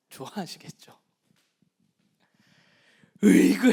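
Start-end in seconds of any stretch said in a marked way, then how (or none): 3.2-3.7 slow, loud mock exasperation; exasperated groan. Parent at a cheeky child, slow loud exasperated groan.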